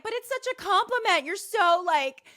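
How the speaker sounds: gushing reassurance. Retold or imitated. Re-enacting earnest reassurance to bothered friend.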